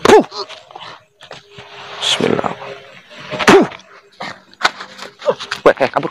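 ordinary delivery

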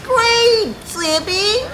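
high-pitched smirky mimicry. Player mimicking how a game character says it, smirky. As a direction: imitate a smug, smirking call. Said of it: "the way he says it so smirkily".

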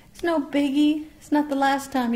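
reassuring pet talk. Real, not acted. Owner comforting Kiwi after it pooped, high reassuring pet talk. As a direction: lift it up high to comfort the bird.

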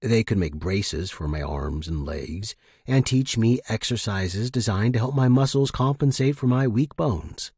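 ordinary delivery

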